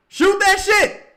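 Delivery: high, loud yell at the screen; hyped fan yelling shoot; shout. Real, not acted. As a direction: bark the order to shoot. Urging a player to shoot.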